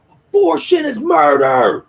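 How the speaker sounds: very high, loud, drawn-out heckle; retold protest scream. Heckler screaming at Starlight, slow imitated scream. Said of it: "he just screams at Starlight".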